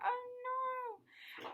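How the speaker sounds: high gasping oh no; shocked exclamation, pitched high; dismayed. A narrator's reading. Narrator dismayed at egg breaking.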